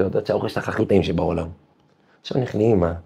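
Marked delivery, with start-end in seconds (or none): none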